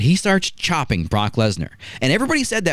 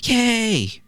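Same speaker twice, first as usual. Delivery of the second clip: high, drawn-out imitation cheer; squealed fan cheer; mock crowd cheer; 11 semitones higher than usual. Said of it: "the crowd erupts for Hogan".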